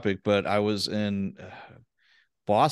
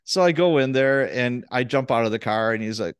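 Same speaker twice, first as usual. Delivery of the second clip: ordinary talk, nothing marked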